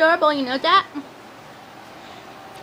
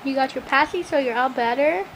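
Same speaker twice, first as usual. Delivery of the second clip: soothing low coo; 9 semitones lower, 5 dB quieter than usual. Real, not acted. Soothing baby after pacifier.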